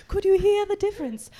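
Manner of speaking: ordinary talk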